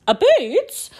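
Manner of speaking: high, rising exaggerated retry; stumbled-word retry. Real, not acted. Podcaster retrying a tripped-over word, high-pitched. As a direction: squeak the word upward.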